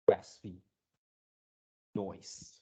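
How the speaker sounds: ordinary talk, nothing marked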